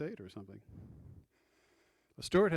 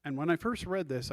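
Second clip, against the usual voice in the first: ordinary talk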